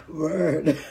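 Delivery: one word squeezed out between laughs; helpless wheezing laughter round the word; can't stop laughing; laughing till crying. Real, not acted. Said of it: "I laugh until I cry".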